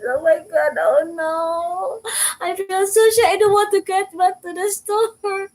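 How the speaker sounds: crying from shame after slipping in public; retold crying. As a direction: sob the words out high and loud. Retelling crying after her fall.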